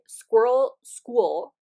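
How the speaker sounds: very slow, each word laid out; slow, clear model of the tongue twister; slow example. Shown as a demonstration. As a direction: spell it out slowly for listeners. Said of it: "I want you to say".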